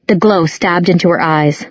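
ordinary delivery

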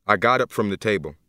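ordinary delivery, nothing marked

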